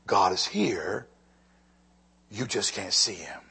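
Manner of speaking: hushed whisper of conviction; secret-sharing whisper. Real, not acted. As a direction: lean in and whisper it. Preacher drops to whisper.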